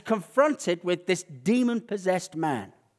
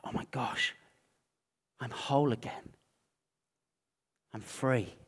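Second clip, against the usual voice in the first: soft, hushed relief; suddenly calm; 3 semitones lower, 7 dB quieter than usual. Retold or imitated. Healed man realising he is free, suddenly calm. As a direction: murmur it in quiet wonder. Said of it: "it's like just calm".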